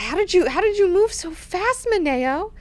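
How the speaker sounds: high, amazed mock whine; astonished question. Freaked-out Duncan asking Maneo, astonished. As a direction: ask it high, in disbelief.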